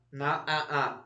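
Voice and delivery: normal talking voice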